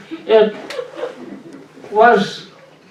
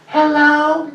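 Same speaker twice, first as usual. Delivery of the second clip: higher squeaky hello on the telephone; high phone voice; 7 semitones higher than usual. Shown as a demonstration. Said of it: "my voice goes up high".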